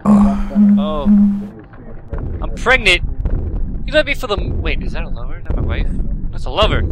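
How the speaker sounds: loud, high, startled exclaims; shocked exclaims. Real, not acted. Gamer discovering he has a lover, loud shock. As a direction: gasp out the twist. Game reveals pregnancy, lover.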